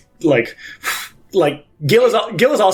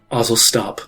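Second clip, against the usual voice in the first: low stern command; 5 semitones lower than usual. Acted. Pontiff commands Ozzel.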